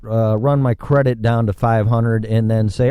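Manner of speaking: normal talking voice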